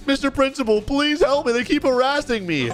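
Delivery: high-pitched, put-on plea; pleading. Kid begging the principal for help, pleading. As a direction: plead like a wronged kid to authority.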